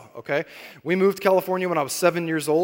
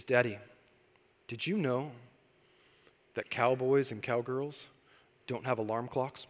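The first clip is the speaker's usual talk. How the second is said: put-on soft, slow, earnest little-girl voice; hushed child telling a secret fact; daughter's voice; 6 semitones lower, 10 dB quieter than usual. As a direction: let the child explain slowly and softly. Daughter shares her discovery.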